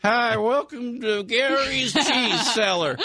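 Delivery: high, slow announcer voice with laughs; cheesy ad voice. Pretend. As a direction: sell it like a goofy radio ad. Radio ad for a shop.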